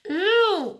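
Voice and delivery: high, loud disgusted yelp; hollered ew. A narrator's reading. Junie B yelling after May spat on her. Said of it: "I hollered".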